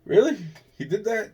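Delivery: imitated gossiping amazement; mock disbelief. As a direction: gasp it out like you can't believe it. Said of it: "it's like".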